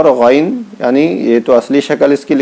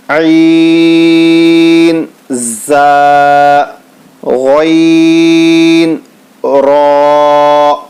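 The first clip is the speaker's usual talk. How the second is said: each letter name stretched long and slow, like a chant; drawn-out letter recitation; 2 semitones higher, 4 dB louder, much slower than usual. Shown as a demonstration. Teacher slowly chanting letter names to students. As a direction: sing out each letter slowly for the class to repeat.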